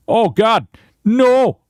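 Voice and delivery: sudden high scared yelp; startled. Real, not acted. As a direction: jump at a scare. Sudden fright in game.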